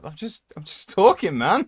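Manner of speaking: loud, high Brummie protest; Birmingham accent. Retold or imitated. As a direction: insist on it in broad Brummie. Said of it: "I'm like".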